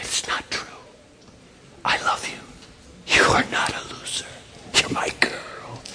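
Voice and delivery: soft unvoiced loving whisper. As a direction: breathe the words tenderly. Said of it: "is whispering to you".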